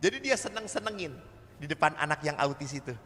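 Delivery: ordinary talk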